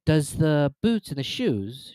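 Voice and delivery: slow puzzled wondering. A narrator's reading. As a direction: puzzle over it aloud. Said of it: "in a wondering tone".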